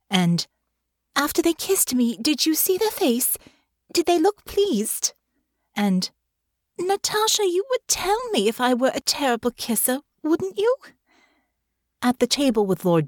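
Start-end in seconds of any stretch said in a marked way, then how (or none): 1.1-5.1 high, anxious lady's voice, slowing at the end; pitched-up worried young woman asking questions. A narrator's reading. Narrator voices anxious Honor. 6.8-10.7 high, anxious lady's voice, slowing at the end; pitched-up worried young woman asking questions. A narrator's reading. Narrator voices anxious Honor.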